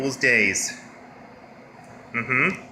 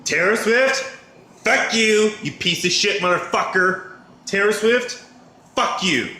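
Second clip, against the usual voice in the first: angry; 6 semitones higher, 3 dB louder than usual. Real, not acted. Cursing out [name] in video.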